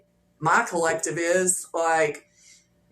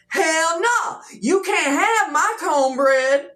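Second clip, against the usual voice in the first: loud, higher, slow hammed-up "hell no"; mock defiant refusal; 7 semitones higher, 4 dB louder, slower than usual. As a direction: play-act guarding your food, loud and drawn out. Refusing to share cornbread, mock defiance.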